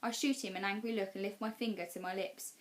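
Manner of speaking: ordinary delivery, nothing marked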